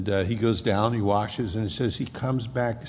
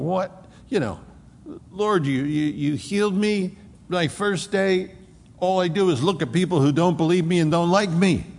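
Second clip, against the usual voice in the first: higher, anxious and searching, voicing his troubled thoughts; bewildered healed man; 6 semitones higher than usual. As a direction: wonder aloud, lost and hurt. Healed man's imagined inner thoughts.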